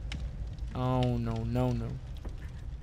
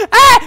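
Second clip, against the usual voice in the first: startled scream; 17 dB louder than usual. Real, not acted. Jump scare in horror game.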